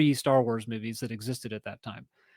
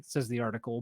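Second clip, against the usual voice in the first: ordinary talk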